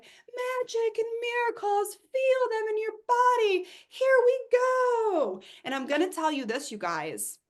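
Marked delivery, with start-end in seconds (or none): 0.0-5.4 high, slow, loud mocking dreamy guru. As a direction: parody a breathless manifesting teacher. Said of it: "were just gonna be like".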